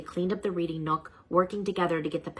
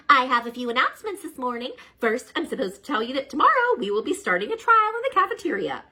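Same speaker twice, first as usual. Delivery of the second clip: higher, louder cheerful schoolteacher; bright teacher voice; 7 semitones higher, 6 dB louder than usual. Acted. Miss Beasley making morning announcements, bright and higher. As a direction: announce it brightly to second graders.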